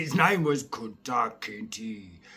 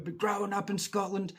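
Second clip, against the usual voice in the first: usual speaking voice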